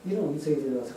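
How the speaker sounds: normal talking voice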